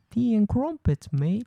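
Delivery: high-pitched mock English accent. Shown as a demonstration. Showing off an English accent to co-host, hammed-up English. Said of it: "my English accent is".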